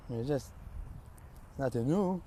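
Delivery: ordinary delivery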